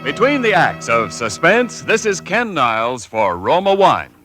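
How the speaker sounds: normal talking voice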